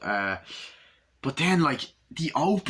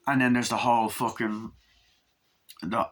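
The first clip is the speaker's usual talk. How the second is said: normal talking voice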